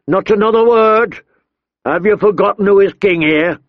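angry king. A narrator's reading. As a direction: remind him who rules. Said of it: "he warned".

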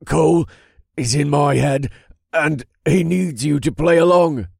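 high, loud urgent plea. A narrator's reading. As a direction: blurt it out urgently. Possessed man reports to witch.

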